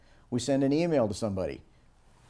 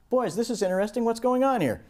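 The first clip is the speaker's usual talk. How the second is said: high, bemused dad voice; amused dad; 11 semitones higher, 3 dB louder than usual. Acted. Dad coming home to a toy-strewn house, high and amused. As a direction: raise the voice way up in amused surprise.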